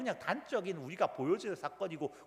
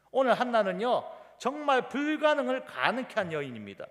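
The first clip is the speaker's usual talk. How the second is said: raised pitch, loud pulpit proclamation; forceful preaching; 8 semitones higher, 7 dB louder than usual. Acted. Preacher proclaiming Hannah's faith to the congregation, forceful. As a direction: proclaim it with full conviction.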